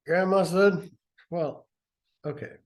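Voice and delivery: ordinary talk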